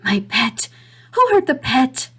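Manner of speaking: rising distress, a madman's cry; agitated old man. Acted. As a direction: fret over the hurt child. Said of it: "he got very agitated".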